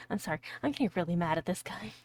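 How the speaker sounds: usual speaking voice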